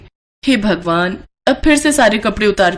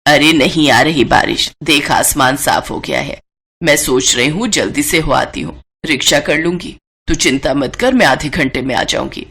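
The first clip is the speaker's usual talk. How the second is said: put-on a lower, older mother-in-law voice; 7 semitones lower than usual. Mother-in-law offers her daughter-in-law help with laundry, lower older voice.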